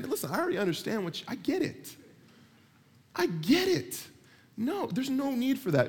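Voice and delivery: high-pitched, mock-pleading exasperation; exasperated God. God to someone over-praying, exasperated. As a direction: cut in, voice climbing on the repeat.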